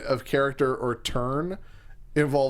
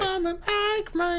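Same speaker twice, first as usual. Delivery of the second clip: high mocking voice. Mocking partner's line.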